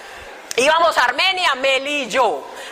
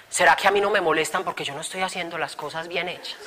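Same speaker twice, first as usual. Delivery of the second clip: quiet inner thought; 6 semitones lower, 6 dB quieter than usual. Retold or imitated. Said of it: "pensé, no lo pronuncié".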